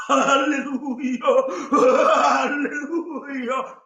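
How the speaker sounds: very slow, drawn-out, laughing alleluia; hammed-up swooning alleluia; mock alleluia. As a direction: parody an ecstatic worshipper. Mocking emotional worship.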